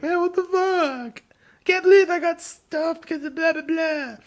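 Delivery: hammed-up crying whine, voice cracking up high; mock bawling. Fired teen wailing to his mom and dad, mock bawling.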